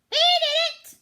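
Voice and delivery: very high, very loud squawk; squawking parrot. A narrator's reading. Silly parrot blaming Pete, shrill squawk. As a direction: screech it like a tattling bird. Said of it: "squawked the parrot".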